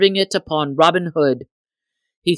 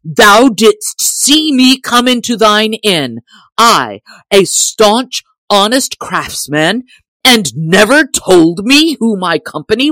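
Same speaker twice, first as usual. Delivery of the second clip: loud, higher raging bellow; snorting angry bull bellow; 5 semitones higher, 7 dB louder than usual. A narrator's reading. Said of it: "in a deep voice like an angry bull".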